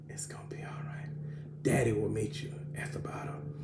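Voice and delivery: soft reassuring whisper; tender whisper. Retold or imitated. Father reassuring frightened daughter at the slide, gentle whisper. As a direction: whisper it to calm a scared child.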